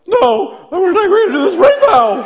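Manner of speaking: very high, loud mock squeal; hammed-up panicky squeak of a scared kid; terrified squeal. Kid challenging the bully as it really sounded, panicked squeal. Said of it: "I'm sure it sounded like".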